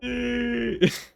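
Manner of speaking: sudden squeaky burst of laughter; high laugh. Real, not acted. Player cracking up at the game's question in a high laugh.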